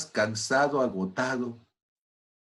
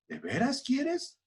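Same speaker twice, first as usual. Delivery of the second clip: slow, much higher and softer reply; voice of God; 13 semitones higher, 4 dB quieter, slower than usual. Retold or imitated. God answering the man's prayer, slow soft higher voice. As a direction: answer gently, as God testing him.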